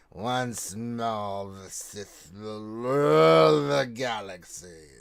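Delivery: slow, drawn-out villain proclamation; menacing Sith voice, stretched out. Acted. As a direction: intone it like a dark lord. Reads Sith quote in villain voice.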